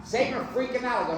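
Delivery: ordinary talk, nothing marked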